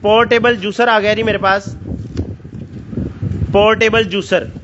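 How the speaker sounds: ऊँची, जोशीली sales pitch; overeager teleshopping pitch. Acted. Raised, excited sales pitch. (बढ़ा-चढ़ाकर बेचने वाली आवाज़)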